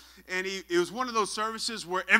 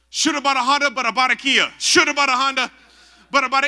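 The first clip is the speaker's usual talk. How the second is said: loud, high mock praying in tongues; faked tongues; 5 semitones higher, 9 dB louder than usual. Friend faking tongues to escape altar call, loud mock chant. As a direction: belt it out as badly faked tongues. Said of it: "I gotta fake it or something".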